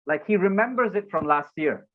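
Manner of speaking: usual speaking voice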